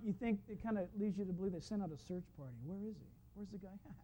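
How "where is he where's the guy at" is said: very soft, muttered questions; puzzled searchers. Acted. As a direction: search party wondering aloud under their breath. Voicing a puzzled search party.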